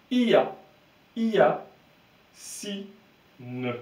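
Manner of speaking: "Ia" broken into i-a, high and loud, then "Si. Ne." apart; syllable by syllable, full vowel i in "Ia"; Y read as I. Shown as a demonstration. Teacher sounding out the name wrongly, Y read as I. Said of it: "Si le Y, je le disais I".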